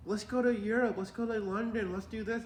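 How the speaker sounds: high mocking singsong; mock the eager travelers, high; mocking friends. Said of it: "They're always like".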